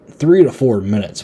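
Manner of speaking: ordinary talk, nothing marked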